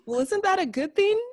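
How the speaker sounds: very high, puzzled question; squeaky mock-naive query; acted innocent confusion. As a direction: imitate someone cheerfully missing the point. Voices Christmas's clueless question.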